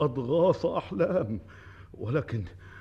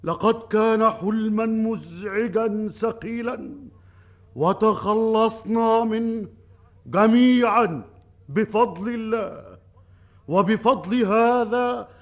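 royal proclamation; 5 semitones higher, 5 dB louder than usual. Acted. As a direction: declaim it to the whole crowd. King proclaims to the people.

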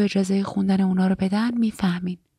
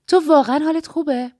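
put-on higher young wife voice; 6 semitones higher than usual. Shunammite asks Agnes again.

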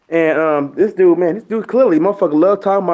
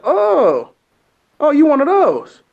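mock surprise; 9 semitones higher, much slower than usual. Mocking the racist coworker.

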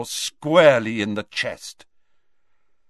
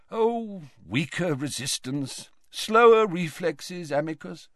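put-on higher, understated old wizard; lightly voiced old man; 6 semitones higher than usual. A narrator's reading. Dumbledore answers Amicus's taunt, mild old man. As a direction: answer the taunt mildly, pitched up.

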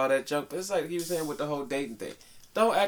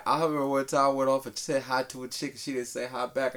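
usual speaking voice

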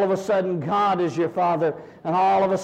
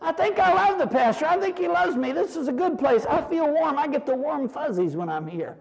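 growing church fondness; 8 semitones higher than usual. Retold or imitated. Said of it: "they begin to say".

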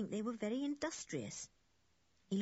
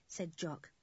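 plain reading voice

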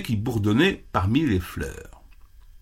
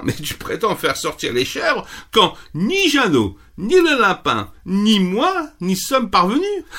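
pitched high, mocking disbelief; a rabbit laughing off a tiny bee; high scoffing rabbit; 8 semitones higher than usual. Rabbit mocking the bee's boast, high and scornful.

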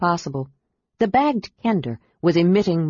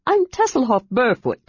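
high friendly voice; Kender introduction; 5 semitones higher than usual. A narrator's reading. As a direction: cheerfully present yourself. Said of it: "he said".

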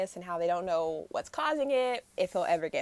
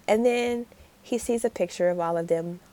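normal talking voice

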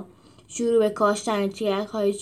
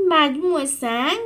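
grumbling; 10 semitones higher, 6 dB louder, slower than usual. A narrator's reading. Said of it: "غر زد".

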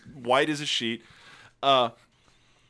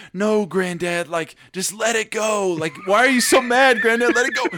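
pleading grandchildren; 6 semitones higher than usual. Retold or imitated. Grown children pleading with angry granddad.

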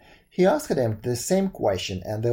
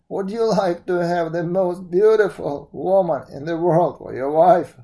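put-on grand old woman making an offer; old fairy woman; 2 semitones higher, 4 dB louder than usual. Old woman rewards the prince.

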